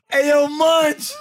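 calling out. Real, not acted. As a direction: draw it out to reach Munch. Calling out across the room.

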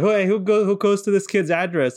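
high, loud mock call; retold yelling. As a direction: holler across to the other drivers. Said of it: "they started yelling like".

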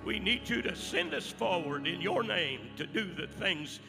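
prayer building to a loud, high climax; fervent prayer. Acted. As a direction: pray it out, rising to a shout. Closing prayer building to full force.

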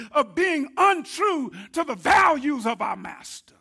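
fiery pulpit crescendo; preaching shout. Real, not acted. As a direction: build the exhortation up to a shout. Building preaching exhortation.

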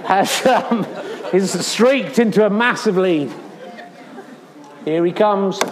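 ordinary talk

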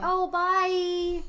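high, sing-song pretend goodbye; mock farewell. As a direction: put on a high little farewell, mockingly. Mocks a needless goodbye at door.

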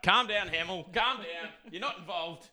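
high-pitched telling-off across the room; calling out. Real, not acted. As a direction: call out to settle a rowdy mate. Mock scolding rowdy man in back row.

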